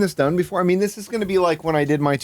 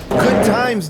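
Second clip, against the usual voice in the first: higher and louder mock cheer; mocking echo; 9 semitones higher, 5 dB louder than usual. Streamer mocking Mark's line, loud and high. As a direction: throw the line back with sarcastic glee.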